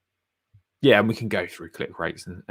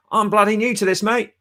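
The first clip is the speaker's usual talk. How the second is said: mock London accent, pitched up high; 12 semitones higher than usual. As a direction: do Robbie as a cheeky London lad. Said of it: "Oh, that's a London accent".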